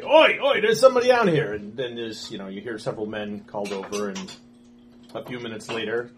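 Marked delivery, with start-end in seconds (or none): 0.0-1.5 loud, high shout for the other guards; guard calling out. Acted. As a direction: bellow to the men nearby.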